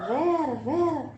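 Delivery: calling Vera slowly while searching the empty house. Retold or imitated. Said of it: "E aí eu falava".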